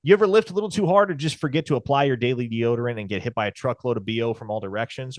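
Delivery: usual speaking voice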